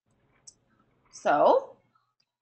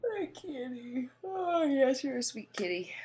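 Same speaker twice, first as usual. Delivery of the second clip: cooing to a cat; 10 dB quieter than usual. Real, not acted. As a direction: coo it tenderly, almost whispering.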